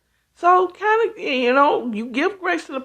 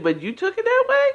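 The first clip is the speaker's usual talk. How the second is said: incredulous; 12 semitones higher, faster than usual. Retold or imitated. Incredulous quote, high and fast.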